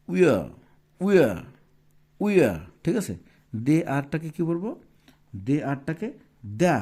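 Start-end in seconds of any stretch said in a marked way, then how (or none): 0.1-2.6 We are drawn together, a little louder; contraction demo. Shown as a demonstration. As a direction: say We are as one word. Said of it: "তাহলে এটা কি হচ্ছে?". (জোরে we are উচ্চারণ)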